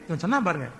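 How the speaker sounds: usual speaking voice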